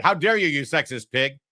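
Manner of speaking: lightly acted offended retort; mock outrage. Imagined woman rebuking the host's flirty greeting, mock outrage. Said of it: "If I said that".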